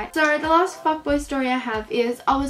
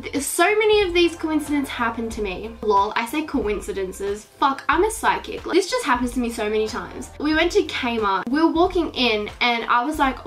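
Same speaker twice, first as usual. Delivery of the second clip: ordinary talk, nothing marked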